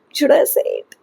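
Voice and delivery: whispered aside. Real, not acted. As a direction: lean in and whisper the question. Hesitant aside about a secret.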